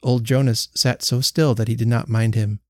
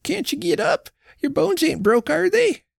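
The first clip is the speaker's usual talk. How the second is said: put-on much higher anxious old wife's questions; worried old woman. A narrator's reading. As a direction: coax him to get up. Old wife keeps pleading.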